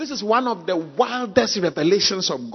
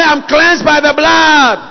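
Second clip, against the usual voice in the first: loud, slow proclamation; shouted declaration; 4 semitones higher, 8 dB louder, slower than usual. Real, not acted. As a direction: shout it out to the church.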